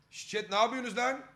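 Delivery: shouting. Real, not acted. Shouting at the chat.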